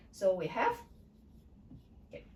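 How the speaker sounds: usual speaking voice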